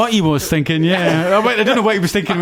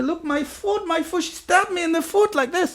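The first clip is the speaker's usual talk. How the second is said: put-on much higher Swedish accent, plaintive; high mock Swedish accent; 13 semitones higher, 4 dB quieter than usual. Cellmate shows his foot in high mock Swedish accent. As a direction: show the stabbed foot in a Swedish whine. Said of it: "Swedish accent".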